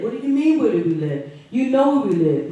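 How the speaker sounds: loud, high, furious disbelief; livid outburst. Real, not acted. Mother confronting police over her son, furious and loud. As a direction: let the outrage burst out loud. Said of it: "livid right now".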